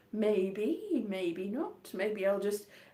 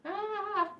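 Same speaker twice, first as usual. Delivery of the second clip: possessed voice; 8 semitones higher, slower than usual. Pretend. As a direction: act taken over by the voice, high-pitched. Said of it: "the voice has taken me over".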